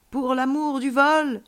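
high, drawn-out rallying cry; exclaiming. A narrator's reading. Alien child cries out for love of flight, exclaiming. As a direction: stretch it out like a battle cry. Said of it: "s'exclama-t-il".

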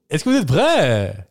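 rallying cry to the crowd, drawn out; mock concert hype. Singer hyping an imagined audience, mock concert hype. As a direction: call out slowly like a stage frontman.

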